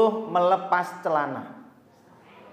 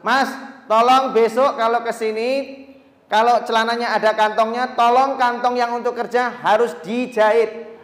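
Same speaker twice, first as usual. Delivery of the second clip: higher, louder boss voice; boss instructing; 6 semitones higher, 6 dB louder than usual. Retold or imitated. Boss telling worker to sew his pockets shut, higher and louder. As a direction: give the order firmly. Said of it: "kata si bos".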